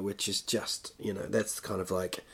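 ordinary talk

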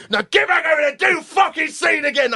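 high, loud, angry command; Ray Winston shouting. Retold or imitated. Actor sending crying student back, imitated angry shout. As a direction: bellow the student back into position. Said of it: "screamed at by the scariest man".

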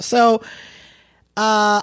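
usual speaking voice

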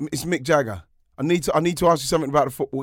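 eager Jagger. Retold or imitated. Jagger on the phone asking Ian about football, fast and loud. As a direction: rush through the request. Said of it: "And he's like".

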